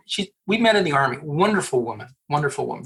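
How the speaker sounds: ordinary talk, nothing marked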